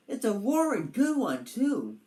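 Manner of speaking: lightly played proud turtle; proud and cheery. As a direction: boast about the fire brightly. Roger proud of fire.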